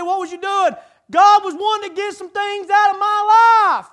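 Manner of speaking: loud, high exclamation to the congregation; full-force preaching. Real, not acted. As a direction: drive it home at full voice.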